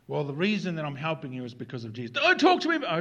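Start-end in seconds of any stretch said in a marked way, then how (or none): 2.1-3.0 loud, high-pitched cut-in; indignant interruption. Acted. Helped person objects to Jesus talk, indignant interruption. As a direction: burst in over the other speaker.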